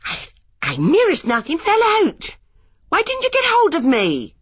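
put-on a shaken young wife rounding on husband; shaken and reproachful. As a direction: demand why he didn't catch her. Lily after near fall.

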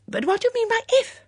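high, quick, excited query; eager questioning. Acted. Excited guest questions the hostess.

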